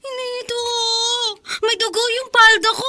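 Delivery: tearful panicked cry; crying in fright. Acted. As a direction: sob out the words, scared. Daughter crying over blood. (umiiyak sa takot)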